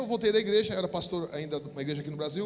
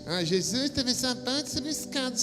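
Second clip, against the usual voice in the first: a hint of a high mocking skeptic voice; 5 semitones higher than usual. Pastor lightly mimicking a skeptic who doubts his vision. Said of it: "Pastor, às vezes é imaginamento".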